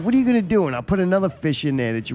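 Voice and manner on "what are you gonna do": higher, louder teasing challenge; cocky jeering dare; mock taunt. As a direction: goad the fish like a playground bully. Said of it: "I'm like".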